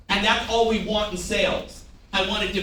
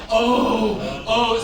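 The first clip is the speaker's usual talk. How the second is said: demonstrated exasperated groan; 4 dB louder than usual. Shown as a demonstration. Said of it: "Like, go".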